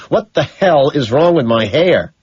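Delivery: slow, loud demand about hair; rising rage. Acted. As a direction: push it out with building fury. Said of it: "my rage quadruples".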